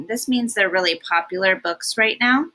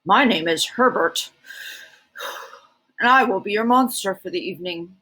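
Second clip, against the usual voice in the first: a lower, fuller take on the monster Herbert. Shown as a demonstration. Reader redoing Herbert's greeting to sound more like a monster. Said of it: "I need to do his voice better".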